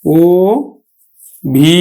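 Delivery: ও held long, then ভি alone; slow ও and ভি, one at a time; separated syllables. Shown as a demonstration. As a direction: pronounce ও, pause, then a long ভি. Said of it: "আমরা উচ্চারণ করব কিন্তু".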